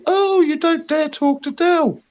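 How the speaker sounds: high, slow mocking sneer; mocking Del's fans. Retelling Del's followers taunting him, high slow mock sneer. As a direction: taunt it like a smug fan.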